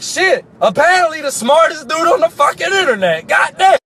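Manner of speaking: exasperated shout. Retold or imitated. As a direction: throw it back at him, fed up. Exasperated retort to smug hacker.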